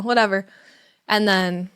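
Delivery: ordinary talk, nothing marked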